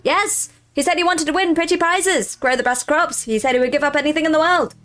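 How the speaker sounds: a grand, eager scarecrow hailing you. Acted. Scarecrow brags about the deal.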